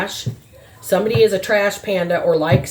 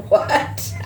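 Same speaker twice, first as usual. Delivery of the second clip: laughing; 6 semitones higher than usual. Real, not acted. Laughing "What?" at the silly holy trash panda. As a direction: laugh out the question in disbelief.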